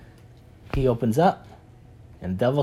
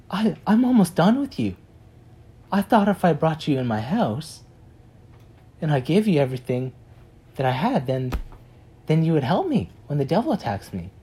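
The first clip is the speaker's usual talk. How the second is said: exasperated complaint; 6 semitones higher, 3 dB louder than usual. Retold or imitated. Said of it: "He's like".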